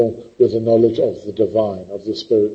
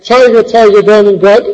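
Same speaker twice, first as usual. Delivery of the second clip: recited poem line; 8 semitones higher, 6 dB louder than usual. Shown as a demonstration. Quoting Blake to class.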